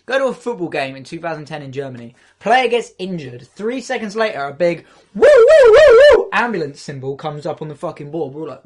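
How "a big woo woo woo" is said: high, loud woo siren noise; siren imitation. Describing a German stadium alert, mock siren woo.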